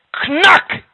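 sudden high, loud crack; loud cracking noise. A narrator's reading. Storyteller shows the log hitting the snake, loud crack. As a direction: hit it like wood striking hard.

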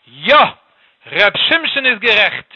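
loud, slow, amused royal outburst; king's emphatic cry of recognition; bursting exclamation. Acted. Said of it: "hat ausgeplatzt in der Gelächter".

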